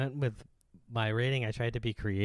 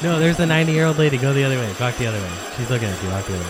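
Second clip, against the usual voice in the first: urgent loud whisper-shout; 3 semitones higher, 10 dB louder, much faster than usual. Pretend. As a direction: hurry them away in a panic. Staged panic, fleeing lady.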